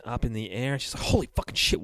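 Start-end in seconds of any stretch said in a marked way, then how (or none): 1.0-1.8 breathless whisper. Retold or imitated. Character panics at the rising sheets.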